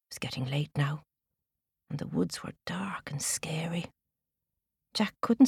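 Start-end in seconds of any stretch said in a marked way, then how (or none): 0.1-3.9 hushed spooky narration; whispering. A narrator's reading. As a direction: whisper it to build fear.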